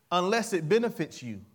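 ordinary talk, nothing marked

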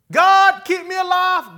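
high, loud plea to God; shouted prayer of desperation; desperate cry. Acted. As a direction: cry out begging for life. Preacher acts out a desperate prayer.

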